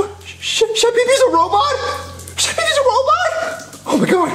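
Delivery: squeaky shocked disbelief; shocked high squeal. Acted. Shocked realization.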